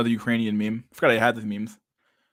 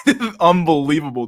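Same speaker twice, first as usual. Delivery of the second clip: amazed; 5 semitones higher, 7 dB louder than usual. Real, not acted. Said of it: "It's not unbelievable".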